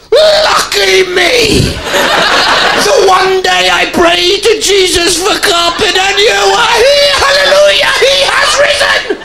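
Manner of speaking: rejoice like a revival convert; ecstatic preacher. Pretend. Comic answering the carpet man, wildly ecstatic and preachy. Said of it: "I should have just gone".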